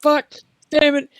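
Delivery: put-on high cranky cursing old man. Wizard stumbling inside, mild high curses. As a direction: swear as things crash around you.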